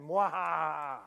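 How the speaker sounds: villainous mwahaha cackle; evil laugh. Pretend. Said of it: "work on your evil laugh".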